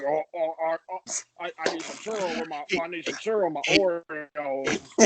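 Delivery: slurred, stumbling mock mumble; hammed-up imitation of someone who can't get words out; inarticulate Shaq. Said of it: "talk real good".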